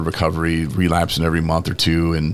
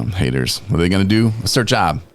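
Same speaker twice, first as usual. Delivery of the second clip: normal talking voice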